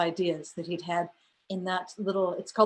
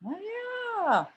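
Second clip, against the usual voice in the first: drawn-out acted oh-yeah of getting it; playful aha moment; dawning realisation; 13 semitones higher, 4 dB quieter than usual. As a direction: let the penny drop slowly. Acting out dawning realisation about Mercedes.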